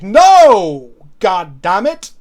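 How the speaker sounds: loud shouted no; mock outburst. Players shout at the opponent casting big, loud yell. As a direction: yell it like the opponent just won. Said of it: "we're like".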